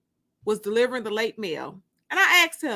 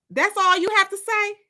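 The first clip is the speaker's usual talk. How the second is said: indignant outburst; 10 semitones higher, 5 dB louder than usual. Acted. Jealous girlfriend confronting Terry.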